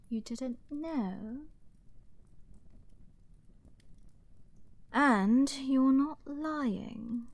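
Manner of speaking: slow wonder. Acted. Villain slowly realises.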